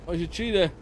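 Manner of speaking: ordinary talk, nothing marked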